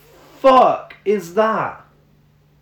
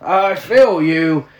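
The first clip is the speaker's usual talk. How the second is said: mock Scott voice; slower than usual. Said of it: "with Scott's voice".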